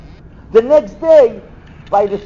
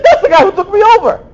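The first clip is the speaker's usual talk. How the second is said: higher, louder, fast astonished blurt; the penny drops, blurt it out; stunned realization; 11 semitones higher, 5 dB louder, faster than usual. Retold or imitated.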